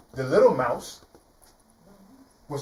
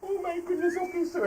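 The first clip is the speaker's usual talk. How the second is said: very high, soft squeaky voice; relieved mouse; 5 dB quieter than usual. Acted. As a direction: squeak out a nervous thanks. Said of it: "the little mouse said".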